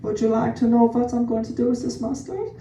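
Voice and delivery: coy, effeminate mock German accent; super femme German. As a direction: play a femme German guy hiding a secret. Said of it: "this super femme guy with a secret".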